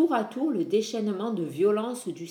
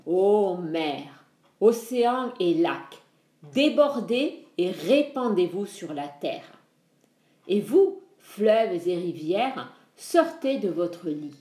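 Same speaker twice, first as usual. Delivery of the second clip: booming, slow sea god commanding the waters; cavernous voice. Acted. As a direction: command the floods in a cavernous boom. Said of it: "la voix caverneuse de son oncle".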